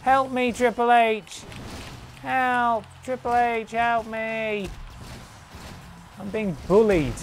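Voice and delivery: mock plea. Pleading for help in game.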